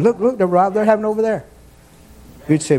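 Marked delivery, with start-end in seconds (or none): 0.0-1.4 quick, higher mock-eager voice; mock excited onlooker. Preacher mimicking someone with a cell phone, mock excited. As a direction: point it out in a rushed, raised-pitch imitation. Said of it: "run around with a cell phone saying".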